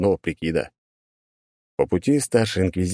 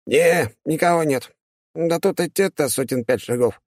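casual demon voice; 10 semitones higher than usual. Acted. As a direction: shrug it off like a smug little demon.

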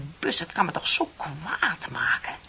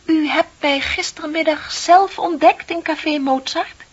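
pointed accusation; 9 semitones higher, 10 dB louder, slower than usual. Acted. Confronting the other woman.

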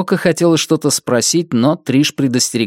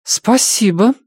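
dignified woman; 9 semitones higher, slower than usual. Acted. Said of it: "с достоинством произнесла женщина".

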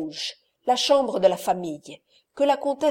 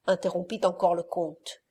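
plain reading voice

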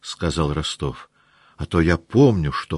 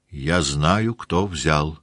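plain reading voice

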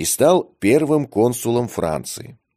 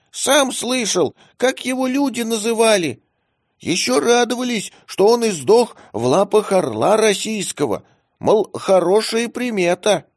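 much higher, small squeaky cat; squeaky kitten voice; 13 semitones higher than usual. A narrator's reading. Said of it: "писклявый голос".

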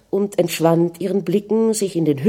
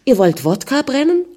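higher, sharp disbelief; shocked question; 7 semitones higher than usual. A narrator's reading. Anna hearing of the distillery, shocked. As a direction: recoil at the news.

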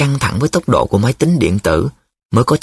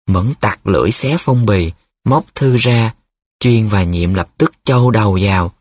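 ordinary delivery

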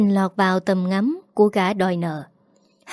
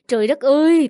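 anguished wail; 6 semitones higher than usual. A narrator's reading. Mother wailing over her son's huge gambling debt, anguished cry. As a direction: wail "trời đất ơi" in grief and anger. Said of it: "oán than trong cơn tức tối lẫn đau khổ".

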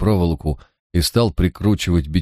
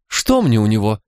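put-on higher, indignant street-girl voice; a sulky young crook, pitched up and wronged; lightly voiced defiant girl, higher than the narrator; Manya, young thief; 8 semitones higher than usual. A narrator's reading.

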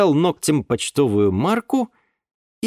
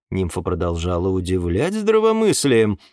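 ordinary delivery, nothing marked

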